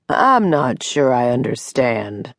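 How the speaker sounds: slow, puzzled man's baritone. A narrator's reading. Doctor puzzled by Mary's account, speaking slowly. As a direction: draw the words out, working it through. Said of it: "the man said slowly".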